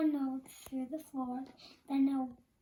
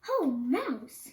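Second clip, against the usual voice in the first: hearty greeting; 8 dB louder than usual. Acted. Bear greets mouse loudly.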